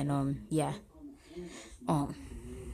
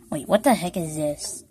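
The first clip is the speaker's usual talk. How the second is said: normal talking voice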